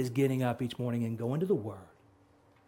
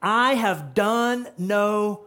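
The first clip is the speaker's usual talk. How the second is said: loud, high, slow preaching; fiery sermon peak; preaching at full force; 6 semitones higher, 6 dB louder, slower than usual. Acted. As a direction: proclaim each word with fire. Preaching climax.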